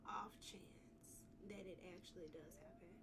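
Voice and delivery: quick, hushed aside; fast whisper. Real, not acted. Streamer murmuring to herself about the route, whispered. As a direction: mutter it under your breath, fast.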